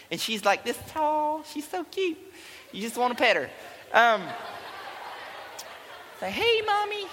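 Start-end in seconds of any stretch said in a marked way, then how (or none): 0.6-2.2 high, soft, tiny cutesy voice; mom's real voice. Acted. Son describes his tiny mom, soft high cutesy voice. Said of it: "She talks more like this". 6.3-7.0 cutesy greeting. Acted. Son greets tiny mom, high cutesy coo.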